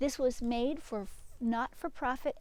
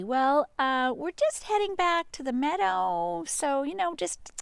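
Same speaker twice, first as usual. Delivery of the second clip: higher, louder jittery babble; nervous sheep; 6 semitones higher, 5 dB louder than usual. Acted. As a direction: talk fast to get away from the wolf. Nervous sheep talking to wolf.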